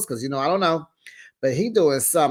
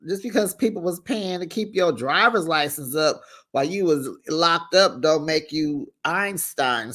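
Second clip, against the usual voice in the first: ordinary talk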